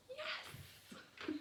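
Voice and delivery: high squeal of delight with a laugh; delighted yes. Retold or imitated. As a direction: squeal like a thrilled child. Emily sees strawberries, delighted.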